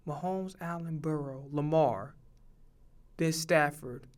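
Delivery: very soft murmur to oneself; tally the names under your breath; low, barely audible musing; thinking aloud. Real, not acted. Recounting the list to himself.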